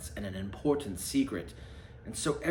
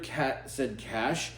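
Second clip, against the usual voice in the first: ordinary delivery, nothing marked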